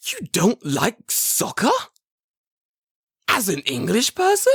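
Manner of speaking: high, loud, drawn-out disbelief; mock incredulity. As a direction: question it like a personal scandal. Incredulous soccer fan, acted out.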